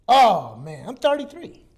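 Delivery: ordinary talk, nothing marked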